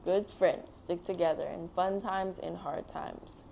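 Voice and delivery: ordinary delivery, nothing marked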